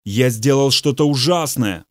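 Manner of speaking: ordinary delivery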